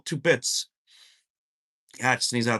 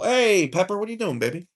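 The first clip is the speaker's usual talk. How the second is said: talking to a dog; 9 semitones higher than usual. Real, not acted. Calling pet Pepper.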